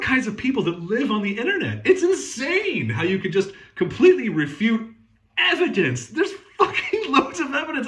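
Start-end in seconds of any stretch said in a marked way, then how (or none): 5.4-7.9 laughing in disbelief. Real, not acted. Laughing in disbelief mid-rant.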